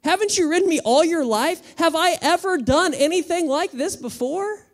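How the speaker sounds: donkey protesting to its rider; indignant donkey. Acted. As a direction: remind him like a wounded old friend. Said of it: "is like".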